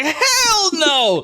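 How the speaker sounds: high, hammed-up yell; appalled bandit crying out; yelled refusal.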